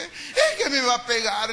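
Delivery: slow, timid child's voice with a hint of crying; frightened child. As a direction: tell him you fear a beating. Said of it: "le dije yo".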